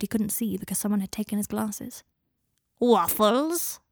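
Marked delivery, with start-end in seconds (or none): 2.8-3.8 a rising, eager elf voice; house-elf voice. Acted. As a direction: offer it like a fussing servant elf. House-elf Kreacher offering breakfast.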